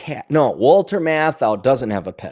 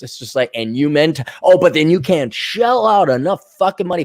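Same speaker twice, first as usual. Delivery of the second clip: ordinary talk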